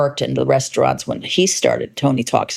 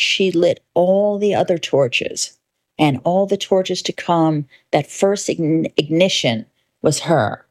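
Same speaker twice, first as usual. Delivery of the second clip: impassioned speech; 4 semitones higher than usual. Acted.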